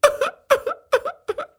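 high wailing sob; a long bitter sob. Acted. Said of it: "crying so bitterly".

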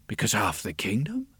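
hushed secretive whisper. A narrator's reading. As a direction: whisper it conspiratorially. Whispered aside.

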